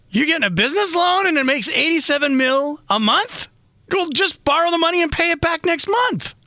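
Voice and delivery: high, disbelieving voice; you can't believe what you're hearing; incredulous disbelief. Retold or imitated. Incredulous thought in his dream.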